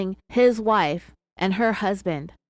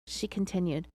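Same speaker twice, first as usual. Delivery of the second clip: normal talking voice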